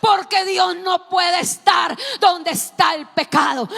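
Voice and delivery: loud, high shouting sermon; preaching at full force. Acted. Preacher shouting Jesus's cry from the cross, full force. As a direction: cry it out at the top of your voice.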